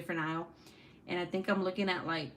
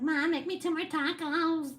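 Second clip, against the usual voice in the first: put-on higher, demanding kid's voice; imitating her son; 9 semitones higher than usual. Mom imitating her son demanding more tacos, voice raised higher. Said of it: "so that's why he's like".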